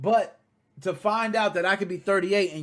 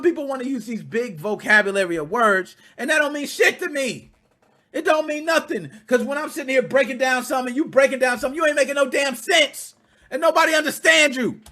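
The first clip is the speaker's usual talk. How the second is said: building, higher and louder rant; heated rant; 6 semitones higher, 5 dB louder than usual. Real, not acted. Host ranting at wordy writers, rising heat. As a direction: get more worked up with each line.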